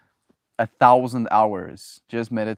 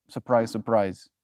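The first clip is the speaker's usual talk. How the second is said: usual speaking voice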